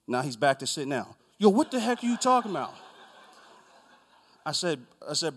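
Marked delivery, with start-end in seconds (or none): 1.4-2.7 high, loud indignant imitation; comic angry outburst; mock outrage. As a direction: yell it as the exasperated man. Mimics angry man's outburst again.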